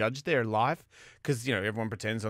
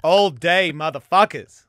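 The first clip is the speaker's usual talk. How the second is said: loud; 5 semitones higher, 8 dB louder, slower than usual. Acted. Hosts ham up show opening.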